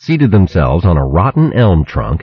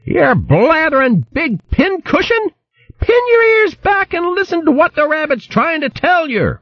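high, scolding country woman voice; shrill hedgehog wife scolding. Acted. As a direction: berate him like a fierce old hog wife. Merklewort scolds Baron.

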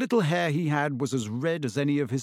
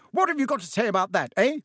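fast angry demand; 8 semitones higher, 3 dB louder, faster than usual. Acted. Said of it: "shouted Missus Weasley".

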